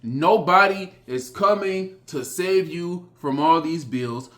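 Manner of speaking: ordinary talk, nothing marked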